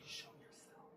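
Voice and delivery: toneless whispered "Show yourself", almost silent; whispering a dare to a ghost. Retold or imitated. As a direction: mouth it in a whisper to the dark. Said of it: "I whisper it with my mouth".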